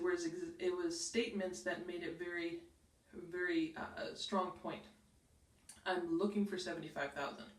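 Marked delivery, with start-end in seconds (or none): 5.9-7.5 firm downward end on "thousand"; no upward lift, just a fact; calm falling tune; falling statement. Shown as a demonstration. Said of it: "there's no up, no question".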